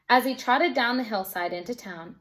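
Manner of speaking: ordinary delivery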